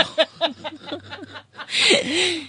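bright high laughter. Real, not acted. Laughs at people's shock.

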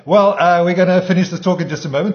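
loud, high radio host voice; radio announcer. Acted. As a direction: announce the break like a DJ.

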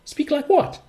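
very high, feigned-innocent question; mock puzzlement, pitched right up; taking the mickey. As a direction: play dumb with a high rising query. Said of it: "I was just taking the mickey".

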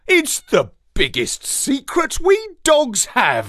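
lightly voiced cartoon dog; dog narrator voice. A narrator's reading. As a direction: speak as a proud puppy. Astropup's dog voice.

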